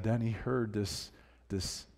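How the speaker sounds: ordinary delivery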